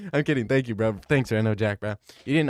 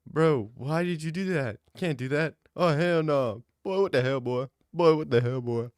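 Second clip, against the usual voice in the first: mock scolding Jesus; 5 semitones higher than usual. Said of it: "he said, he said".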